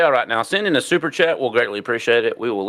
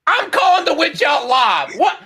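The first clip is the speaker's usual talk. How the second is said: outraged shout, much higher; 3 dB louder than usual. Real, not acted. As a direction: yell in mock outrage. Calling the witch out live.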